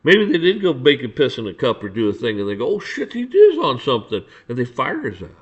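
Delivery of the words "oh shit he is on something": high-pitched startled realisation with a laugh; mock surprise. Bosses finding out the officer is on something, mock surprise. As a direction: imitate a sudden amused discovery. Voicing officials' sudden discovery.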